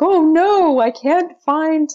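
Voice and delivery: high, drawn-out mock wail; distressed game character. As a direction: play a cartoon townsperson in hammed-up despair. Acting out distressed puzzle-game characters.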